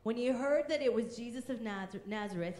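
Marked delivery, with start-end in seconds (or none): none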